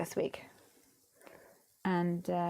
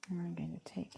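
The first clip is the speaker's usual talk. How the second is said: whispering; 2 semitones lower, 8 dB quieter, faster than usual. Real, not acted. Craft work aside.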